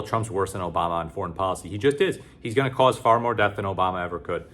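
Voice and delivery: normal talking voice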